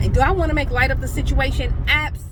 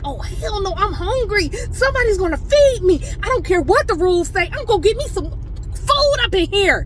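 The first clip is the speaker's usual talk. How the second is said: much higher and louder, comic demand; hammed-up hungry indignation, pitched way up; hungry outburst; 10 semitones higher, 4 dB louder than usual. On the pureed-food diet, a higher, louder mock demand for food. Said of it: "I was like".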